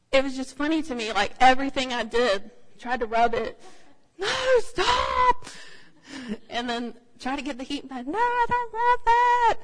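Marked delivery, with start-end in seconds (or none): 4.2-5.5 high loud protest; child's shout. Retold or imitated. Daughter pushing mom away, high loud yell. 8.1-9.6 put-on high, loud, slow child protest; whiny refusal. Daughter refusing the heating pad, high loud whine.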